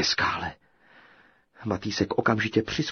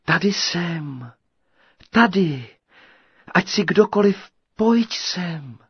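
high, raised call of a sweet maiden; 9 semitones higher, 6 dB louder, slower than usual. A narrator's reading. Unseen girl calls to whoever is coming, sweet high call. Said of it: "volal sladký hlas".

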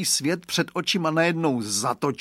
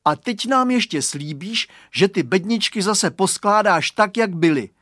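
loud, high insistent bargaining; firm conditions; 6 semitones higher, 5 dB louder than usual. A narrator's reading. Boy setting the trapped bully another condition, firm. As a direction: lay down terms with raised voice. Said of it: "klade si Radovan další podmínku".

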